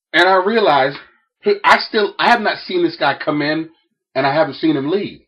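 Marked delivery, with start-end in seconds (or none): none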